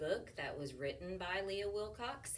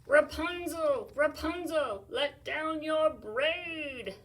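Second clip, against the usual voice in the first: loud, higher, slow last call; desperate calling; 8 semitones higher, 12 dB louder, much slower than usual. Acted. Prince calling up to Rapunzel one last time. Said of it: "cupped his hands for one last try".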